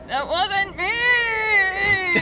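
hammed-up whining denial; Doctor Brewer voice. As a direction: wail it like a cartoon captive. Reader voices captive Doctor Brewer.

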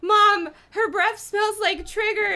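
put-on high, slow whiny kid; hammed-up whining boy; miserable boy. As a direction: plead it miserably. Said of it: "Evan exclaimed miserably".

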